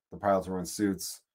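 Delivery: ordinary delivery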